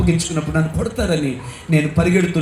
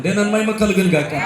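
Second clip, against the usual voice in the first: praise shout; 4 semitones higher than usual. Acted. Preacher praising.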